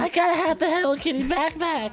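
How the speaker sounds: put-on very high, squealing girly voice; high squealy fangirl gushing. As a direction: gush like a kid who must have it. Fangirl voice continues.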